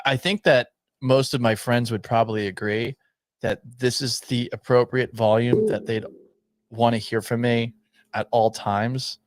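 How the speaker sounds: normal talking voice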